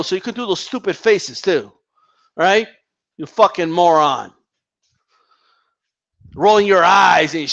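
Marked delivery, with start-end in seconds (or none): none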